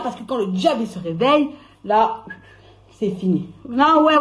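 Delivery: normal talking voice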